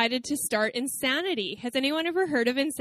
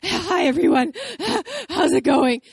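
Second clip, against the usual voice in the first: mock panting gasps between words, pitched higher and louder; out of breath; 6 semitones higher, 6 dB louder than usual. Preacher imitating herself greeting the church, winded from the stairs, panting. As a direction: ham up being winded after the stairs. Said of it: "how, like, out of breath I was when I walked up the stairs".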